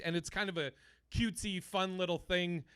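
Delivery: ordinary talk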